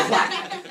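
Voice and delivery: high, loud laughing whack; laughing whack sound. Retold or imitated. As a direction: smack it out with a laugh. Sound of rock hitting head.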